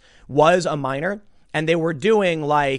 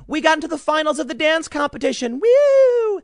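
put-on much higher, squealing girl's cheer with a loud woo; mock a girl shrieking with glee; retold excited scream; 11 semitones higher, 4 dB louder than usual. Retelling a girl's cheer to the guards, high squealing. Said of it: "one girl starts screaming".

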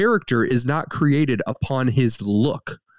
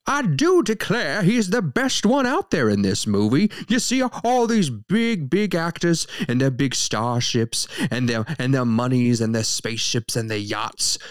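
put-on Southern accent. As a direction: slip back into the Savannah Southern voice. Southern accent again, "I do declare.

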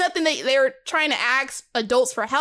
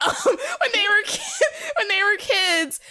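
high giggling restart; laughing; 10 semitones higher than usual. Real, not acted. Friend giggling while describing the characters' childhood. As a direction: laugh through the words as you restart.

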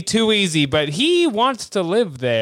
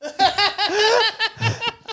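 high laugh; 14 semitones higher than usual. Real, not acted.

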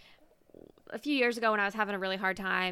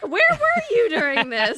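high, loud, slow disbelief; incredulous; 12 semitones higher, 12 dB louder than usual. Retold or imitated. Asking her friend where he hid, in loud disbelief. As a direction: stretch it out in delighted shock.

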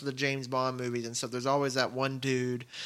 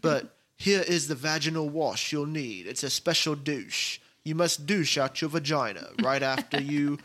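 put-on British accent. Gadget man briefing Mrs Bond on a poison capsule, in a light British accent. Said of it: "that was a perfect accent".

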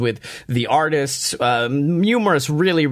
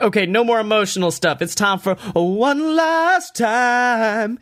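hyped announcer voice; 9 semitones higher than usual. Retold or imitated. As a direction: stretch it out like a hype man. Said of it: "X was like".

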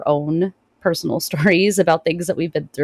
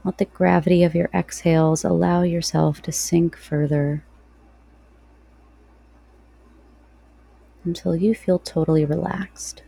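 guided meditation. Real, not acted.

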